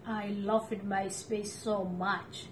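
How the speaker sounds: ordinary delivery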